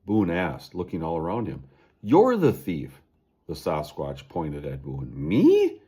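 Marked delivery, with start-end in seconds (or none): 2.0-3.0 loud, angry accusation; old Sasquatch shouting blame; accusing. Acted. 5.1-5.8 very high, loud startled cry; loud high shocked question. Acted. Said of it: "Boone cried".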